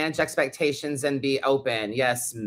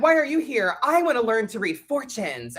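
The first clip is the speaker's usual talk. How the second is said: higher mock exchange; teacher and student; 7 semitones higher than usual. An imagined tarot teacher quizzing a teenage student, higher voices. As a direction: toss the question, then the naive answer. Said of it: "you know, I'm like".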